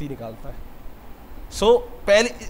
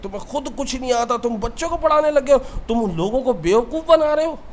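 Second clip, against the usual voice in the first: mock tirade, pitched higher; retold berating in a raised pitch; imitating angry scolding; 5 semitones higher than usual. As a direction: scold like an outraged critic, a little hammed-up. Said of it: "इतनी गालियाँ बकी, इतना बोला".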